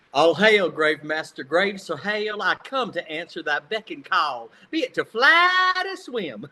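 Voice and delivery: a light Southern drawl on Shakespeare; young actor reads Shakespeare in his Southern accent. Retold or imitated. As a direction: recite Ariel's lines in a Mississippi accent, rising high at the end. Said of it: "so I had a big Southern accent".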